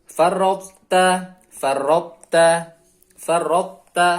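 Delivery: "farraqta" slow and loud, heavy t held into light ta; heavy unechoed tha. Shown as a demonstration. Teacher gives second example, loud slow recitation without echo. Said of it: "istilah dari huruf ta masih ada".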